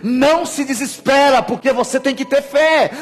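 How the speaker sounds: high, loud, urgent exhortation; shouted sermon climax, slowing at the end; full-force preaching. Real, not acted. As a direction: drive it home to the congregation, loud.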